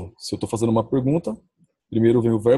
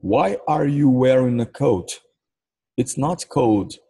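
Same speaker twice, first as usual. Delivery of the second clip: slow model sentence; 2 semitones higher, much slower than usual. Shown as a demonstration. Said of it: "Como é que fala essa frase toda em inglês".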